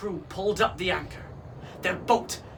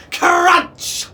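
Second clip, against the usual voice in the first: high, loud burst; big storybook crash; explosive sound word; 11 semitones higher, 13 dB louder than usual. A narrator's reading. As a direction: shout it as a splintering impact.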